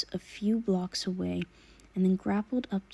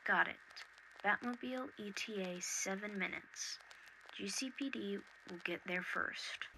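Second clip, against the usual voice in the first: Oracle over comms; 13 dB quieter than usual. Acted.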